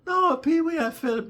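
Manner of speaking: very high falsetto cooing; high woman voice. Retold or imitated. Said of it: "and then she said".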